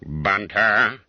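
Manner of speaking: harsh stern call of a name; a schoolmaster who has found the culprit; rasping bark. Acted. Master discovering the boy under his table, harsh stern bark. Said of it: "a voice compared with which the filing of a saw".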